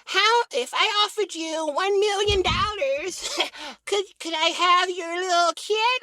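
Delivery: lightly voiced lady, slow; mock sweet request, unhurried; a hint of a posh stranger; polite lady asking. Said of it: "She go".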